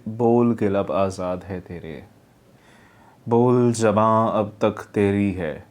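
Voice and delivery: low, slow, lifeless reading; dull delivery. Shown as a demonstration. Teacher imitates the student's dull voice-over, low, slow and lifeless. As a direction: read it with no energy at all. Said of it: "इस तरह से डल जा रहा है".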